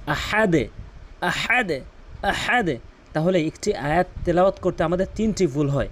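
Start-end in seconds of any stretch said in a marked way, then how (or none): 0.1-2.8 আহাদে with the দ bounced downward; downward qalqala. Shown as a demonstration. Teacher showing downward qalqala on আহাদে. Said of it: "নিচের দিকে ধাক্কা দিয়েছি".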